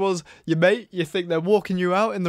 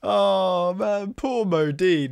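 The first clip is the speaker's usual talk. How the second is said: ordinary talk, nothing marked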